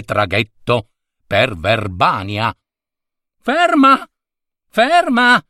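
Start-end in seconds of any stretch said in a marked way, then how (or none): 3.4-5.4 high desperate cry of ferma; a man calling stop from his balcony; shouting. Acted. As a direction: yell after him, pitch way up. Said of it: "gridò il signore".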